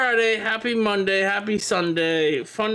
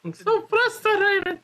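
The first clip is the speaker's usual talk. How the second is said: mock frustration; 11 semitones higher, slower than usual. Mocking friend's complaint.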